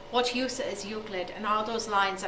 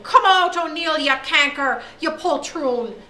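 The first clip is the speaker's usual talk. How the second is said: loud high yell, last word slow; 6 semitones higher, 10 dB louder, slower than usual. Acted. Mister O'Day yells at O'Neill through the door in fury. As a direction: bellow it through the classroom door. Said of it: "Mister O'Day is yelling".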